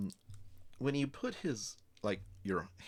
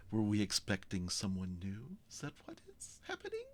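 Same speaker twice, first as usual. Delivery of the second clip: sly lurker. Acted. Tatters in an alleyway to Lapis, sly questioning.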